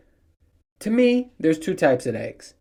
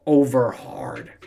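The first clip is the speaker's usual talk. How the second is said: mocking, drawn out; 2 semitones lower, much slower than usual. Mocking the egg order.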